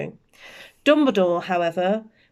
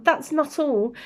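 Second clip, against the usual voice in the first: higher, a touch of trembling; 7 semitones higher than usual. Acted. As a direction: hold back tears, lightly voiced. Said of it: "McGonagall's voice trembled".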